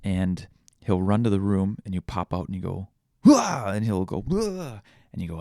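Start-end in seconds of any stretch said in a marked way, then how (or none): 3.2-3.6 loud, high falling "whoa!"; playful startling whoop. Retold or imitated. Dad pops out at his son, loud playful whoop. Popping out at toddler. 4.2-4.8 high falling "whoa!"; imitated toddler whoop. Toddler answers dad popping out, imitated high whoop.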